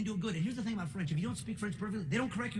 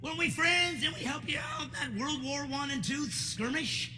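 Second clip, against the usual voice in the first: mock hurt pleading; 7 semitones higher than usual. Comic pleading to France.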